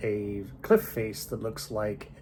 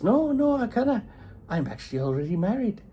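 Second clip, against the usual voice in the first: put-on high girlish Katie; 12 semitones higher than usual. Katie refusing Paddy's proposal.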